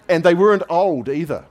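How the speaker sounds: high loud punchline; loud exclaim. Real, not acted. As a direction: exclaim it loudly.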